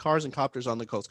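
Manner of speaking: quickened repeat of a tongue twister; fast tongue twister. Shown as a demonstration. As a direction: race through the tongue twister. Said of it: "Try saying that five times fast".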